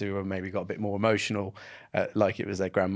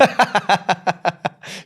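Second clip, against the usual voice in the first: high bright burst of laughter; big high laugh; loud laugh; 10 semitones higher, 8 dB louder than usual. Real, not acted. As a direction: laugh hard at the joke. Laughs at dress sense joke.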